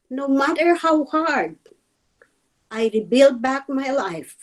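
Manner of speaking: a teacher reading her own lament aloud; poem recitation. Acted. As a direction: recite it like a grieving elegy.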